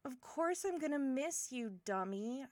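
firmer, livelier affectionate retort; fond exasperation. Acted. Assuring departing friend of being missed, affectionate exasperation. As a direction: insist on it as if it were obvious.

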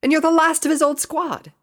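high, loud insistence; insistent. A narrator's reading. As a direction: drive the argument home, pitched high. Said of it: "Rainbow insisted".

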